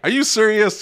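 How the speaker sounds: can't believe the offer; disbelief. Retold or imitated. Retelling own disbelief.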